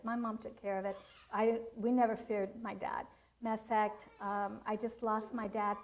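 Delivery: ordinary talk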